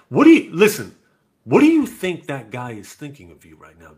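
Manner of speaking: loud, emphatic parental lecture; loud retold dad lecture; lecturing dad. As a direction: press her to see it his way.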